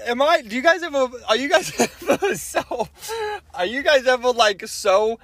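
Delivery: high, giggly halting words; still laughing. Real, not acted. Vlogger to viewers, still giggling helplessly. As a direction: fight through the giggles to finish. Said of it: "am I crying or am I laughing".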